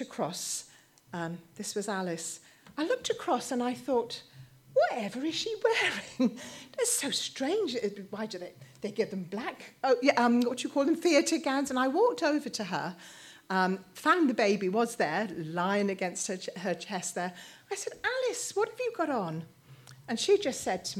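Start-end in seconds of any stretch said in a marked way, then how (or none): 4.7-11.7 puzzled surprise. Retold or imitated. Retold surprise at daughter's clothes. 18.0-19.4 surprised call. Retold or imitated. Mother calls to daughter, surprised.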